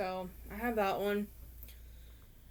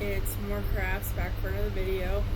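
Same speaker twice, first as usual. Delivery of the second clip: raised voice over fan noise; louder, pushing past the fan; talking over a fan; 8 dB louder than usual. Real, not acted. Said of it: "hopefully you guys can hear me better now".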